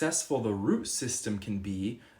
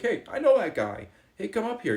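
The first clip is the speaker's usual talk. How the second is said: excited call; 11 semitones higher, 5 dB louder than usual. Retold or imitated. Someone at table calls out.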